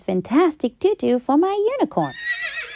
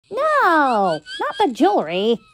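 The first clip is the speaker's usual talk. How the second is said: screech; 4 semitones higher than usual. Acted. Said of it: "I screeched".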